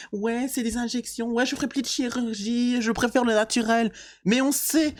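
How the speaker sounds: high, gushing influencer impression; mocking influencer voice. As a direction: mimic a fake-sweet Dubai influencer. Mocking influencers living in Dubai.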